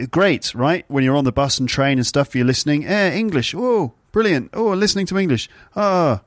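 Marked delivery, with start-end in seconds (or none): 2.9-6.2 high, exaggerated oohs of a pleased listener; mock delighted listener. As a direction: ham up a listener thrilled to hear English. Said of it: "those are the noises that you make".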